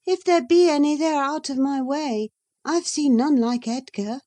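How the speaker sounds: put-on a young lady answering back, pitched high; Catherine. Catherine's voice in the reading.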